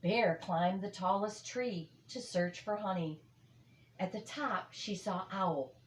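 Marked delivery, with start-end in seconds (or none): none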